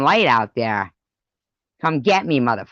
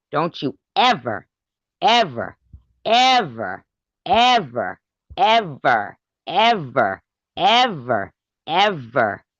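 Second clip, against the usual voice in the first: each ever higher and punched, then falling; ever hammered; 4 semitones higher, slower than usual. Real, not acted. Warning a rival streamer, stressing each ever. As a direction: drive every ever home, one by one.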